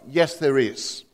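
lightly voiced God answering from above; calm powerful voice. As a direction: speak as a calm, assured voice from the sky. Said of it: "A calm, powerful voice".

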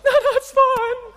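very high, laughing fake-nice; sugary forced politeness through a laugh; fake polite. Preacher bitten by the dog, forcing a high laughing "it's fine". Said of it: "on the outside, I was like".

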